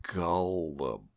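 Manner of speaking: Gullible drawn out slowly, syllable by syllable; a slow teasing demo of Gullible; slow gullible again. Shown as a demonstration. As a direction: stretch Gullible out until it sounds like orange. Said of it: "if you say the word gullible slow enough".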